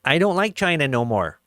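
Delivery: lightly voiced mock Trump; mock his pouting complaint; a hint of Trump; imitating Trump. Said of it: "you're gonna have Trump saying".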